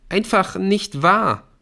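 high, slow, loud protest; upset cry of disbelief; agitated denial. A narrator's reading. Erik keeps protesting, shaking head.

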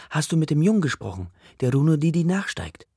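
put-on worried boy firing questions at his father; urgent questions. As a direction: press your father for answers, fast.

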